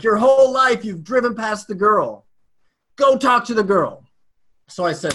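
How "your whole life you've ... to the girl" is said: high, forceful talking-to yourself; retold self-scolding. Man ordering himself back to the girl, exasperated. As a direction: urge yourself on, loud and exasperated.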